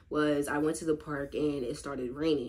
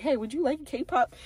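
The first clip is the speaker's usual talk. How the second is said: high, bright offer; friendly offer; 9 semitones higher than usual. Retold or imitated. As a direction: call out cheerfully, pitched up. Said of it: "I was like".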